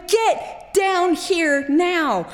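slow, high stretched-out parent shout; parent yelling. Retold or imitated. Parents downstairs calling kids off the roof, shouting. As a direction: bellow it up the stairs like a panicked parent. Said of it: "our parents are downstairs going".

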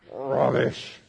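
rubbish said low and nasty with a small growl. Shown as a demonstration. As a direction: growl rubbish like it disgusts you. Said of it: "say it like it's nasty and kind of growl a little bit".

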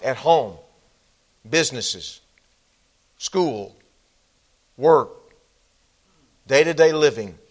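prayer that swells loud on the list of places, then settles; fervent closing prayer. Acted. Pastor praying over his congregation at the close, fervent prayer. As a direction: pray over the people, building in force.

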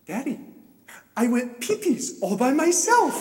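put-on high, proud small-child voice; delighted little daughter. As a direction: imitate a child proudly telling daddy. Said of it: "has this huge smile and she goes".

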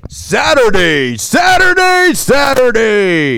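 slow booming monster truck ad call; monster truck announcer. Pretend. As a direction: stretch it out loud and high like an arena ad.

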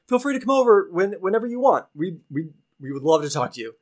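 high and quick, overeager; eager young knight. Acted. Young adventurer invites the kobolds over, high and fast.

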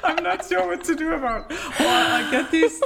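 high, giggling through the words; laughing. Real, not acted. Telling the podcast hosts about Klaus's job offers, giggling. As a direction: speak while laughing at the absurdity.

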